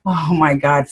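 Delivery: normal talking voice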